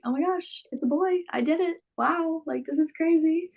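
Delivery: high-pitched, excited wonder; reenacted amazement. Retelling her reaction to the birth.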